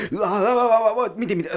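overwhelmed by the staging detail; excited gushing. Acted. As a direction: theatre nerd losing it. Gushing over fridge door.

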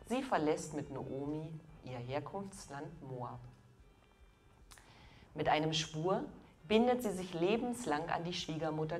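ordinary delivery

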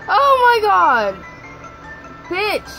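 loud, high startled shriek; panicking gamer. Real, not acted. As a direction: shriek at the jump scare. Jump scare in horror video.

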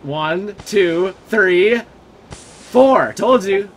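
emphatic counting. Real, not acted. Counts out smokes to prove point.

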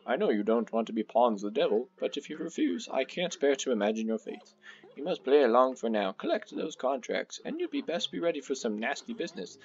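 sing-song storybook guardian, gravely advising; wise old Elder Kettle. Acted. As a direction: warn the boys like a theatrical elder. Voices Elder Kettle's dialogue in game.

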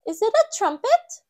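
put-on high, loud wondering little girl; Peppa. A narrator's reading. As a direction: guess out loud like a curious child. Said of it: "says Peppa".

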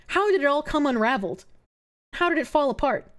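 usual speaking voice

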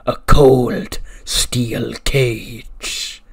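loud, hugely drawn-out villain promo; menacing announcement. Retold or imitated. Wrestler naming the match for rival, slow booming menace. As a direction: stretch every word into a loud threat. Climax of acted wrestling challenge.

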